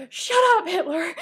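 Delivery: high, very loud mock yell; imitated outburst. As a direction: imitate an exasperated character yelling. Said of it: "He goes, he goes".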